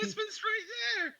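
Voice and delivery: high-pitched mock-scandalised cry; scandalised rebuke. Woman warning an admirer that her husband is present, scandalised. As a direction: shriek it in pretend outrage. Scolding a flirt, husband nearby.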